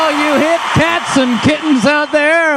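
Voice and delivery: booming show-host welcome; hype host shout. Acted. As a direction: greet the listeners like a hyped DJ. Host opening the show loudly.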